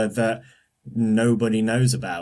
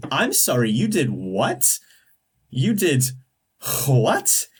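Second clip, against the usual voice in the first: mock disbelief; 4 semitones higher, slower than usual.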